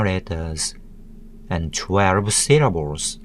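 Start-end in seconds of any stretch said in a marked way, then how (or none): none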